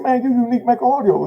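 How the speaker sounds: high-pitched retold amazement; imitated wonder, pitched well up; astonished recognition. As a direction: act out an officer amazed at who this is. Officer's recognition, retold once more.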